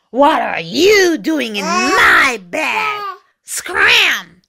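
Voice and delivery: slow, growled demand, stressing "my"; growly dog. A narrator's reading. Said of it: "a big, deep, growly voice said".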